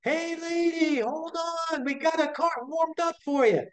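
high, far-carrying call across the store; shouting across a Walmart checkout to an old lady; friendly shout. Retold or imitated. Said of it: "I yelled at the top of my lungs".

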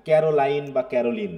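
ক্যারোলাইন with a long ai, then ক্যারোলিন with a short i; two pronunciations. Shown as a demonstration. Teacher showing the class two ways to say ক্যারোলাইন. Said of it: "দুটা কিন্তু উচ্চারণই আমরা সঠিক".

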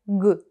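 «г» as a hard voiced g sound alone; short, punched «г», like g in go. Shown as a demonstration. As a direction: isolate «г» as a hard g, not a letter name. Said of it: "лунає дзвінко як".

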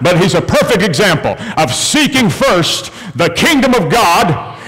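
full-throated pulpit proclamation; impassioned preaching. Acted. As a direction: preach it with rising conviction.

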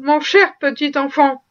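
usual speaking voice

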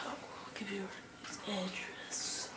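soft, unvoiced conspiratorial whisper; mock whisper. Vlogger whispers his friend's address to viewers. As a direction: lean in and whisper it like a secret.

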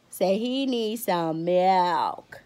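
very slow, stretched-out mock announcement; drawn-out joke line. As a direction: drag every word out playfully. Jokey echo about son's milk.

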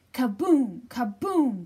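loud, falling boom; booming kaboom. A narrator's reading. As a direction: boom it out like a rolling boulder. Rock rolling sound effect.

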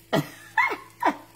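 laugh. Real, not acted. Laughs at the juicy burger.

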